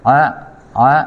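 อะ started with a soft throat ayn. Shown as a demonstration. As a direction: voice อะ gently from the throat as Arabic ayn. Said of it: "ออกเสียงไอน์ให้นุ่มนะครับ".

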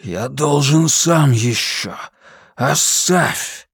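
slow, laboured, rasping words; strained croak. A narrator's reading. Captain refusing help after his seizure, strained and croaking. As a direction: force each word out with effort.

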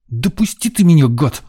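put-on high-pitched, furious little-boy protest; boy's angry hiss. A narrator's reading. Boy fights the man's grip, hissing angrily. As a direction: hiss it like a cornered kid. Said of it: "зашипел Ванька".

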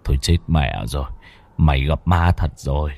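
low, soft, halting dread; halting scared words. A narrator's reading. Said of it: "mà nói ngắt quãng". (nói ngắt quãng, trầm và nhỏ vì sợ)

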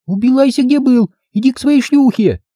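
child playing the nagging wife; ringing little-girl voice. Girl playing wife demands money, high ringing child voice.

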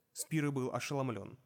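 ordinary delivery, nothing marked

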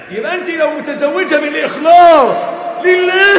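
loud, high, impassioned preaching; preaching shout. Real, not acted. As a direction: hammer it home to the crowd. Preacher peaks at full force.

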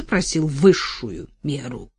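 usual speaking voice

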